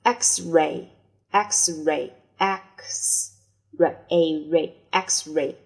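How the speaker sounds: slow, split into X and ray; slow breakdown. Shown as a demonstration. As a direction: take X-ray apart slowly. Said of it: "teacher breaks word slowly". (慢速拆读 X-ray)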